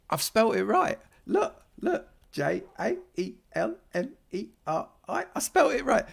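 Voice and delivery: high, loud, squeaky-excited outburst; excited disbelief. Real, not acted. Streamer spelling a member's name right at last, excited disbelief. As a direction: blurt it out high in triumph.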